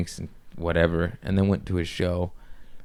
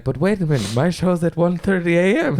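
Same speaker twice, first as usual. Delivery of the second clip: German accent attempt; 11 semitones higher, 3 dB louder than usual. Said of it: "I don't know how to do accents, but I'm gonna try".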